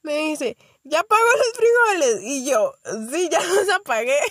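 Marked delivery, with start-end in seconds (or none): 0.8-2.2 high, loud motherly question; woman caller. Retold or imitated. Woman caller calling back. 3.1-4.2 laughing reply. Real, not acted. Answering while laughing.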